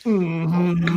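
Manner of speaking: annoyed mm-hmm. Retold or imitated. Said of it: "she does when she's mad at me".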